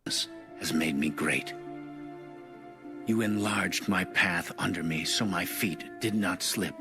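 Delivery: ordinary talk, nothing marked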